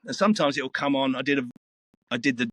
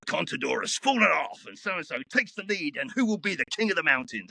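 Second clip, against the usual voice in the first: higher-pitched, dramatic sports promo read; live race announcer; 7 semitones higher than usual. Shown as a demonstration. Voiceover artist recording Tour de France update, dramatic announcer read. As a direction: build it up like a race teaser. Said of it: "I'd come up here".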